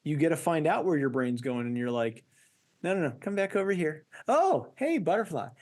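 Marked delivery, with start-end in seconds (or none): 2.8-5.5 playful self-talk. Pretend. Mimicking a wandering mind.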